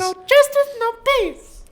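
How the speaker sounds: high, loud squeaky squirrel chant. Acted. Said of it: "but in a squirrel voice".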